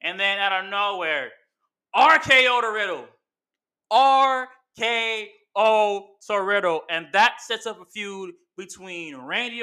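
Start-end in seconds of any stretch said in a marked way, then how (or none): 1.9-3.1 loud, high call of "R K O to Riddle."; excited call. Real, not acted. Fan recapping the match, loud excited call. Calling the finishing move. 3.9-6.8 slow, stretched-out "R K O to Riddle." like a ring announcer; drawn-out announcer call. Real, not acted. Fan repeating the finisher call, slow announcer style.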